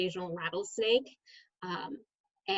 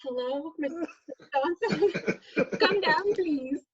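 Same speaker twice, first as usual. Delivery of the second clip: office lady on intercom; 7 semitones higher, slower than usual. Retold or imitated. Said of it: "the office ladies were like".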